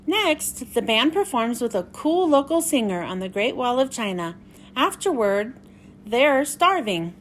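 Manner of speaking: bright, lively picture-book read-aloud; reading to children. Acted. Miss Michelle reading Pete the Cat to young viewers, lively story-time voice.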